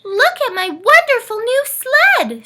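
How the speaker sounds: very high, loud delighted shriek. Acted. Ness showing off her sled, shrieking. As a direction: squeal over the new sled. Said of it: "shrieked Ness".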